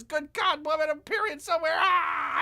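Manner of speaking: high, loud, fast outburst of disbelief; exasperated shout. Real, not acted. Streamer yelling at the woman in the clip to stop talking, shouted. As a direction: throw your hands up and yell at the screen.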